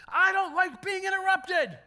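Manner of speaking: loud, high, slow indignant retort; powered up. Retold or imitated. Friend scolding the pastor for interrupting, loud and high. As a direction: hit each word hard and high. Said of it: "she powered up on me".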